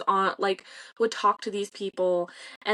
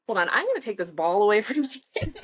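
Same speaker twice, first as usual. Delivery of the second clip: laughing; 2 semitones higher, faster than usual. Real, not acted. Laughing aside about her kid.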